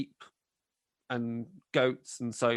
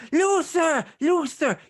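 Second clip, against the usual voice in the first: much higher, loud shrill calling wife; 7 dB louder, slower than usual. Acted. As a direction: screech for your husband across the yard. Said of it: "His wife is still going".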